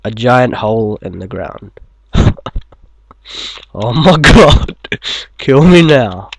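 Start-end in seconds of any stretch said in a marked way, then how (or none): 3.7-6.3 exasperated. Real, not acted. Groaning at bad joke.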